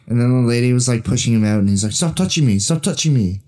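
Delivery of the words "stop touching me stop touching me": high-pitched, insistent mock complaint; mock indignant protest. Friend retells man pushed out by bar owner, mock indignant. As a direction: imitate someone objecting to being shoved.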